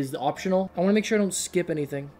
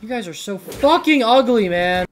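louder, mocking the monsters; loud jeering insult; 4 semitones higher, 8 dB louder than usual. Real, not acted. Gamer jeering at ugly enemies on screen, loud mocking insult. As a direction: throw the insult at them with scorn.

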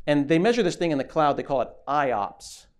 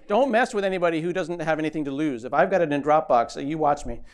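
ordinary delivery

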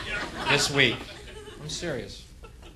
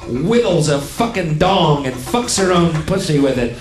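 loud, raised-pitch tirade; angry rant; 5 semitones higher, 6 dB louder than usual. Acted. Abusive rant at a redneck.

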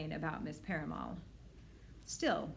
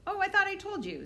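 frowning director; 13 semitones higher, 5 dB louder than usual. A narrator's reading. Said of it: "said Mister Rutger with a frown".

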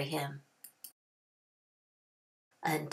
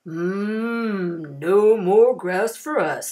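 slow, louder lowing voice; cattle voices; 8 dB louder, much slower than usual. A narrator's reading. Longhorn cattle tired of grass, slow lowing voice. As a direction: moo the words like hungry cattle. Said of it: "they cried".

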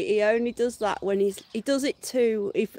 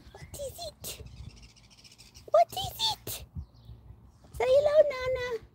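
squeaky pet talk. Real, not acted. Owner cooing at the dog about its toy, squeaky high. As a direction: squeak it to the puppy.

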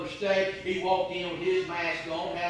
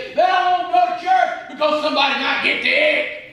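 scornful outcry; 10 semitones higher, 7 dB louder than usual. Real, not acted. Preacher scornful climax.

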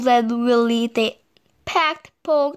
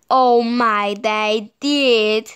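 usual speaking voice

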